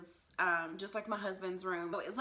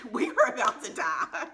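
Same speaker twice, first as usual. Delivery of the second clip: laughing; 4 semitones higher, 9 dB louder than usual. Real, not acted. Laughing at the scary memory.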